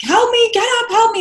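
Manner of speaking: very high, loud reenacted cry for help; urgent high-pitched retold wail; panicked crying plea. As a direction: relive a frantic plea to be helped up. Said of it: "And I start crying".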